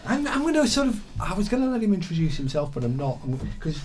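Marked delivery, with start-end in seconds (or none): none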